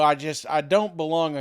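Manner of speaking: usual speaking voice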